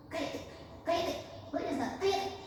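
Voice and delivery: high, sharp imitation of a parent yelling shut up; hammed-up angry parent hushing a child; mock parent shouting. Parent silencing crying child.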